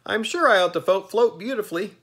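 very high, bright, cheery Jack voice; pleasant Pumpkinhead. A narrator's reading. Jack cheerfully reassuring the ferryman, very high and pleasant. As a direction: sing it out, untroubled and friendly.

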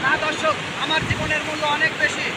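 ordinary talk

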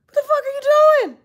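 high, sharp shout landing on you; shocked yell. Retold or imitated. Retelling a blowup, a high shocked shout. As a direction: yell it in disbelief. Yelling at him in a retold scene.

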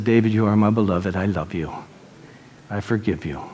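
low, softening fatherly reassurance; tender forgiveness. Retold or imitated. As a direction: forgive your son gently, dropping low and quiet. Father embraces and forgives son.

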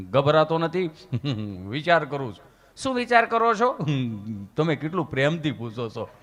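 bashful mumble. Retold or imitated. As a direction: go coy with the nurse. Gabho shy with the nurse.

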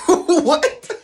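cracking up, high-pitched shock; incredulous laughing. Retold or imitated. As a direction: burst out in amused disbelief. Reacting to Satan accusation, laughing.

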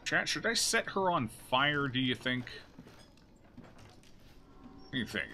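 usual speaking voice

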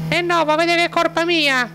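high falsetto accusing wife; squeaky high woman, blaming; Gustava voice. Acted. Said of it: "la Gustava".

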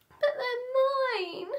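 slow high tearful whine; a small boy near tears; upset child. Acted. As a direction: Patrick losing toys. Patrick upset.

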